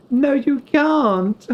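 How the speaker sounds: high, very slow, pleading protest; mock film protest. Streamer imitating Harry Potter, high slow pleading protest. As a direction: draw out the protest high and desperate.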